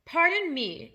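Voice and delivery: plain reading voice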